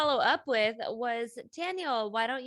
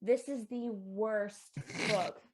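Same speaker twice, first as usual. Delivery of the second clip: slow, low, heavy verdict; slow deadpan verdict; 3 semitones lower, much slower than usual. Acted. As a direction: drag it out, dead serious. Dramatic verdict on the book.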